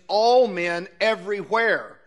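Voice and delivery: two strong beats, on "all" and on "everywhere"; double emphasis. Shown as a demonstration. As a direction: stress both ALL and EVERYWHERE. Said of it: "Notice the double emphasis there".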